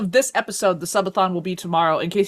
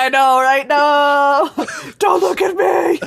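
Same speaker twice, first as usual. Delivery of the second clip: high, loud comic wail; hammed-up panicked shout; mock panic; 8 semitones higher, 8 dB louder, slower than usual. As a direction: cry out in pretend horror. Mock panic about reporter showing up.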